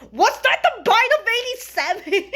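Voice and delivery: high, loud mock-shocked blurt; excited blurt. Fan reacting to Freddy's chomp with the meme, excited blurt.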